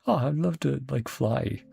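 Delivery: normal talking voice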